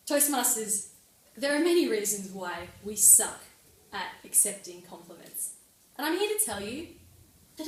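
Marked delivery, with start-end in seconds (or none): none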